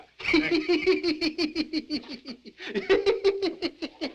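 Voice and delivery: long, high delighted hobo cackle. Acted. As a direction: laugh hard at your own joke. Hobo laughs at his sandwich.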